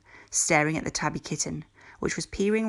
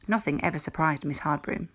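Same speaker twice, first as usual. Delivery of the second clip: plain reading voice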